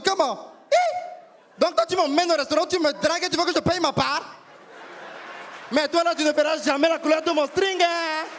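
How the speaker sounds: outraged friend putting her date in his place; indignant African woman. Retold or imitated. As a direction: tell him off in a strong African accent. Newly arrived friend scolds date.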